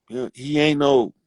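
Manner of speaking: usual speaking voice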